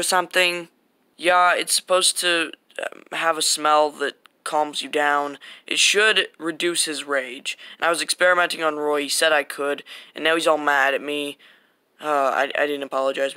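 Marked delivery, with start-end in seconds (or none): none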